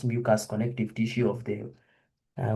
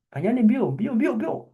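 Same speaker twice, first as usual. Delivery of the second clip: quick, higher urging in Swahili; urging hurry; 9 semitones higher than usual. Real, not acted. Lecturer urging students to hurry, pitched up. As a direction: hurry the class along.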